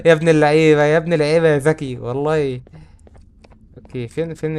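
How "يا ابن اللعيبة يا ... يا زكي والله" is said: loud triumphant cry; excited praise. Real, not acted. Gamer praising his own clever play, triumphant. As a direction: crow it out proudly.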